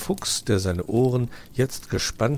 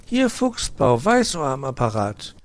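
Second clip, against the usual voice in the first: high, louder proper phone greeting; polite young fox; 6 semitones higher, 5 dB louder than usual. Acted. Nephew answers the phone, proper boy voice.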